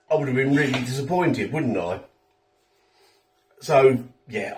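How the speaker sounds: ordinary talk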